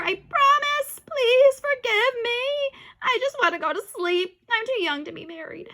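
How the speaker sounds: high, loud panicked begging; desperate pleading. Acted. Boy begging not to marry Andrea, high. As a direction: beg for mercy.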